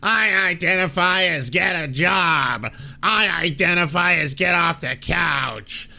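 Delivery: hammed-up mock shout. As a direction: bellow each line like a bad punchline. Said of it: "one joke stretched out".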